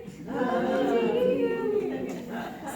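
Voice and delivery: high, loud and slow cooing nonsense; slow cutesy baby talk. Shown as a demonstration. Teacher repeats the mirror coo on request, slow, high and loud. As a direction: stretch each silly word out lovingly. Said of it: "You want me to do it too?".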